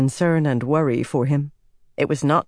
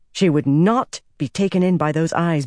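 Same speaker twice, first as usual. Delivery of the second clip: ordinary delivery, nothing marked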